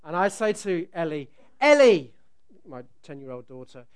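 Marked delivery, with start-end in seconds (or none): 1.6-2.1 loud call across the pool. Retold or imitated. Dad in the pool calling loudly to his daughter. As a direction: call her name from the water.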